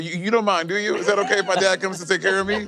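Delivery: embarrassed daughter. Acted. Player plays embarrassed daughter.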